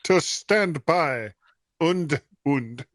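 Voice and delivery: slow, put-on German accent; deliberate mock-German announcer; terrible German accent. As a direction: stretch it out in a bad German accent. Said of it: "Say it in a slightly terrible German accent".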